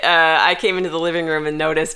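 normal talking voice

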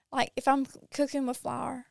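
ordinary talk, nothing marked

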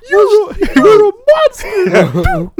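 high, loud, slow squeaky accusation; gingerbread man voice. Retold or imitated. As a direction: squeal it like a tortured cookie. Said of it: "he sounds more like the gingerbread man".

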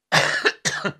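coughing on tea gone down wrong; real cough from swallowed tea; choking on a drink. Real, not acted. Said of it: "that tea went down the wrong way".

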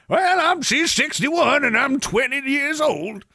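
high, drawn-out hillbilly caricature; hammed-up hillbilly. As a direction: put on a silly high, slow yokel voice. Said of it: "Why do you talk like that?".

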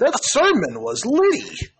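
put-on high, loud, slow old lady voice; hammed-up churchy old lady; old church lady. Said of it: "some old lady".

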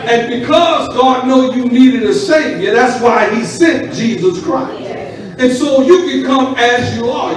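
building loud preaching. Acted.

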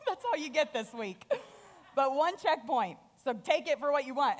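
laughing. Real, not acted. Pastor joking about one checkpoint.

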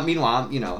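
normal talking voice

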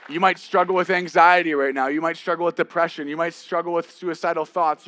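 loud, high, insistent preaching; rising pulpit intensity; full-force preaching. Acted. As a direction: hammer each "you might struggle" line to the congregation.

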